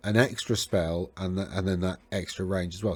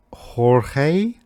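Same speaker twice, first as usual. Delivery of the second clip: Spanish accent; 6 semitones higher, 8 dB louder than usual. Real, not acted. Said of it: "my Spanish accent's terrible".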